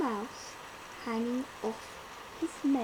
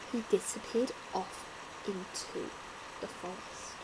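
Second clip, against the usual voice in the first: softer and softer to a whisper; fading away; 5 semitones lower, 8 dB quieter than usual. A narrator's reading. Narrator fades as mouse disappears, whispering. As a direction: let it vanish into the forest.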